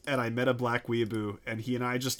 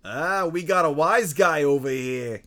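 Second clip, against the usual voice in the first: slow, louder mobster taunt; wise guy voice; 4 dB louder than usual. Acted. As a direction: drawl it like a mob boss. Friends trading tough guy voices.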